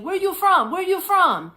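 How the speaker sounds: high, loud, insistent broken English; pushy vendor repeating. Retold or imitated. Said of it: "just kept saying".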